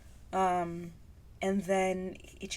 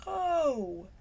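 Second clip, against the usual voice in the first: emotional gasp; 8 semitones higher, 4 dB louder than usual. Real, not acted. Overwhelmed after singing the lyric.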